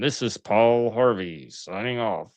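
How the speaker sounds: put-on slow old-time radio host sign-off; unhurried, measured radio impression; Paul Harvey.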